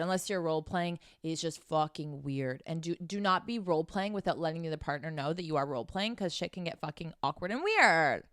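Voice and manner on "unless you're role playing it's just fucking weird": ordinary delivery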